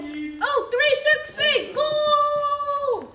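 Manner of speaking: high, loud goal shout; announcer yells the goal; excited goal call. Pretend. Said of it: "I'll be the game announcer".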